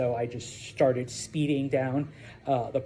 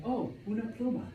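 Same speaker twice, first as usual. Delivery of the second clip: fast, high put-on student voice saying Oh, una pluma; student's answer; 7 semitones higher, 4 dB quieter, faster than usual. Student Logan answering teacher, lightly voiced. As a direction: chirp the answer like an eager student.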